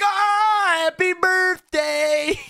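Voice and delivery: long high whoop into a slow holler; mock celebratory shout. Everybody at the table joins in, imitated celebratory shout. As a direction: cheer like a whole crowd, loud and drawn out.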